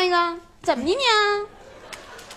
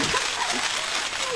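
laugh; 7 semitones higher, 9 dB quieter than usual. Real, not acted. Partner laughs mid-banter.

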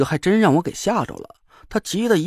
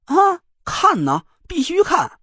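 high, drawn-out eager yes; excited; 12 semitones higher, slower than usual. Acted. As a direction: squeal it with giddy eagerness. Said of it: "郭永哲兴奋地呲着牙".

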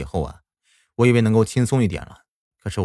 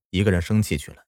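plain reading voice

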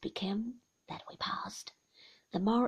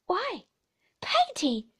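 put-on rueful child's plea, pitched up; 8 semitones higher, 6 dB louder than usual. A narrator's reading. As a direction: say it ruefully, louder and higher.

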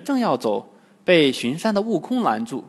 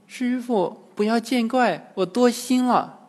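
put-on flustered girl; 8 semitones higher than usual. A narrator's reading. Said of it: "慌着篮子说".

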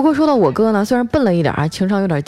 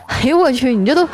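high-pitched, jeering ridicule; mocking brother; 9 semitones higher than usual. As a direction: act out a brother making fun of his sister. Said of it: "就蹲在一旁嘲笑我". (模仿哥哥高声嘲笑)

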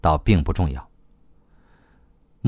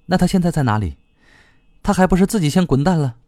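high-pitched, irritated retort; agitated blurting; 8 semitones higher, faster than usual. A narrator's reading. Retorting about the runaway husband, agitated. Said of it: "他脱口而出，心情禁不住越发烦躁起来".

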